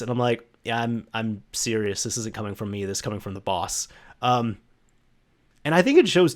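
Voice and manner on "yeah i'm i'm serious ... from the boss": serious. Retold or imitated. Breaking the boss's order, serious.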